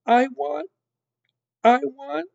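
high, loud repeated wail; sobbed wail. A narrator's reading. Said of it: "sobbed Mig".

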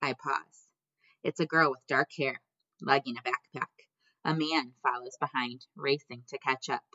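ordinary delivery

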